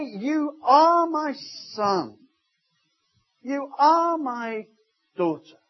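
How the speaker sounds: slow, with each word stretched; slow, ringing affirmation; slow joyful declaration. Retold or imitated. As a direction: proclaim it as God's delight. God's voice at baptism.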